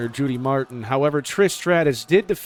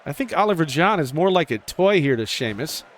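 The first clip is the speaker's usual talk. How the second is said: ordinary delivery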